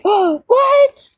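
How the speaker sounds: squeaky high "Oh, what?"; high, startled mock gasp of a question; mock shocked squeak. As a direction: squeak it out like a stunned stranger. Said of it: "And they're like".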